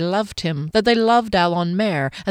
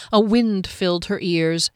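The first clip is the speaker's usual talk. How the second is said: ordinary delivery, nothing marked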